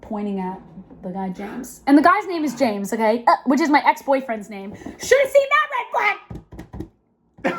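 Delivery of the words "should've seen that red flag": high, bright-eyed mock alarm; a squealed red-flag alert; exclaiming. Real, not acted. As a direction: sing out the warning way up high. Mock warning about ex's name.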